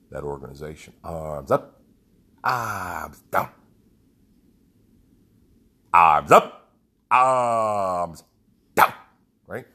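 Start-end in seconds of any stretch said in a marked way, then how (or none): 1.0-3.5 loud calls to the group; shouted drill commands. Retold or imitated. Leader repeating the arm drill for the group, loud. 5.9-8.9 very loud stretched commands; full-volume drill shout. Retold or imitated. Leader shouting the arm drill across the whole field.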